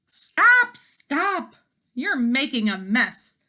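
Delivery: very loud high "Stop, stop!" then scolding; shrieking hen. Acted. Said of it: "cried the first hen".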